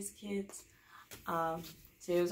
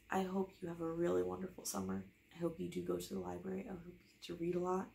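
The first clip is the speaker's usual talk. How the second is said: plain reading voice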